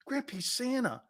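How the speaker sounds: a hint of a pleading kid; little kid protesting. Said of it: "kid voice retold".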